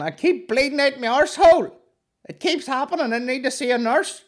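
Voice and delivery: hammed-up squeaky complaint to the desk; mocking the woman. Woman at clinic, mocked.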